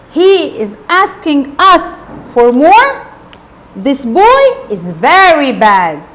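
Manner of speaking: slow, loud-edged outrage, rising then falling; drawn-out scandalised accusation; acted glaring indignation. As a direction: condemn the boy with heavy emphasis. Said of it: "He glared at Oliver like this".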